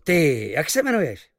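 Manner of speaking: high, loud question across the deck; impressed sailor calling. A narrator's reading. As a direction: hail the stranger with eager curiosity. Sailor calls to the marksman. (zvědavý námořník volá na cizince)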